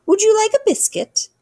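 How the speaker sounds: high, loud queenly voice; regal queen. Acted. Queen offering the visitor a biscuit, high and regal. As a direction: offer it grandly, pitched high.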